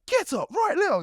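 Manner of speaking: high, loud shout at the trainee; coach shouting. Retold or imitated. Coach orders him off the floor, loud shout. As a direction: bark it like an angry coach. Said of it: "It's like shouting at me".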